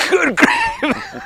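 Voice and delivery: amused exclamation. Real, not acted. Host reacts laughing.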